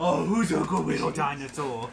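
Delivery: put-on cooing baby talk to a pet; dog talk voice. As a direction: coo at the dinosaur like a puppy. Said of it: "I'm gonna do the dog talk".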